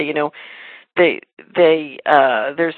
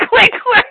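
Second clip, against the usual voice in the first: imitated phone click; 7 dB louder than usual. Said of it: "the phone went click".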